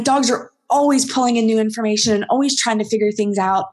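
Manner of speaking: usual speaking voice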